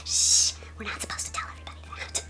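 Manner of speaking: hushed, quick mock-confidential whisper; secretive whisper. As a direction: shush them and whisper the secret. Mock secret told to viewers.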